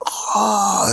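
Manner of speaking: sharp high cry of pain; pained scream. A narrator's reading. Bakugo cries out when his stomach hurts, a sharp high scream. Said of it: "screams out".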